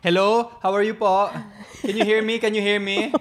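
high-pitched mock tito yelling hello; tito on the phone. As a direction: play a tito shouting into a cellphone. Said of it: "how your titos answer the cellphone".

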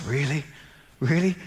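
barely voicing it, stunned; stunned quiet. Father on the phone hearing she's healed, hushed disbelief. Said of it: "He suddenly goes very quiet".

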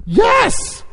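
very high, loud yelp; frantic prompting. Acted. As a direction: squeal with unbearable suspense. Men at peak of suspense.

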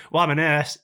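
a hammed-up huffy nurse; mock nurse, indignant. Retelling a Facebook argument.

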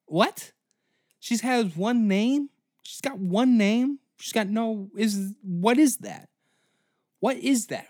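high, loud incredulous ranting; shrill disbelief at the award winner; mock outrage. Real, not acted. Comedian ranting in loud disbelief.

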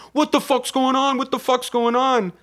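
high-pitched, frantic retold alarm; mock panic. Passengers panicking as gremlin kills people.

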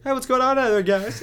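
high, loud mock calling out; hammed-up imitation of an actor; weird line reading. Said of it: "It's, like, really weird".

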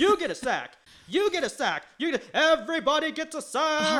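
high-pitched hype, each gift announced grandly; over-the-top giveaway announcer; mock giveaway shout. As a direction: hand out prizes like an ecstatic host, rising to the last. Oprah-style giveaway to players.

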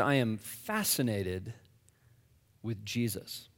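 slow, soft and low; quiet earnest confession; sincere. Retold or imitated. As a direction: say it gently, meaning it. Sincere answer to skeptic.

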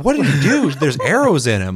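imitating Sarah, high and loud; shocked shout. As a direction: shriek in shock. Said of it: "And she's like".

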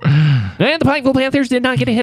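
high, booming sports announcer. Acted. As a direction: call it like a play-by-play announcer. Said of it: "your announcer voice".

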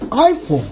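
iPhone with a high I, falling away; American-style iPhone. Shown as a demonstration. Showing listeners American pronunciation, stressed first syllable in iPhone. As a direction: hit the I of iPhone high, drop off. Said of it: "应该按照老美的那个发音".

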